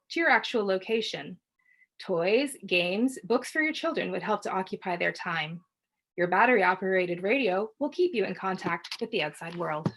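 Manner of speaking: ordinary delivery, nothing marked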